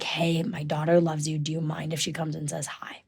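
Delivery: very soft, trailing into a whisper; mom's hushed request. Retold or imitated. Mom asking a star to greet her daughter, hushed. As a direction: quietly imitate a polite mom, ending whispered. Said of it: "mom comes up to me, and she was like".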